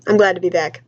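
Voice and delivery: ordinary delivery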